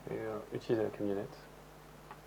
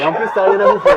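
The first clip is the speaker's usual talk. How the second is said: teasing; 11 semitones higher, 8 dB louder, faster than usual. Pretend. Teasing about no food.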